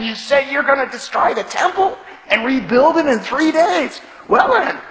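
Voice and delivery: loud, high scornful shout; taunting shout. Retold or imitated. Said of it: "shouting abuse".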